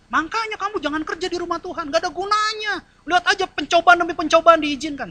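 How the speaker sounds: high, taunting tempter voice; the devil whispering doubt, high and mocking; devil's voice. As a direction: tempt him like the devil, sneering. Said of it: "Dan iblis mulai".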